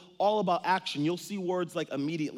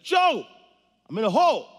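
much higher, louder shout to a friend; calling out to friend; 9 semitones higher, 10 dB louder than usual. Acted. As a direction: call up to Joe from the hole.

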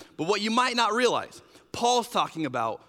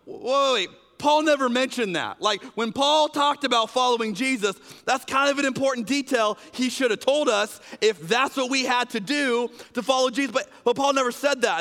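alarmed protest; 6 semitones higher, 4 dB louder than usual. Retold or imitated. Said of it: "they're going".

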